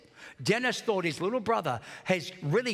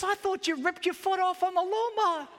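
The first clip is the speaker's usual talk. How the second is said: higher, a hint of a worried brother; lightly voiced alarmed brother; 11 semitones higher than usual. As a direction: toss it back half-panicked. Brother's alarmed reply retold.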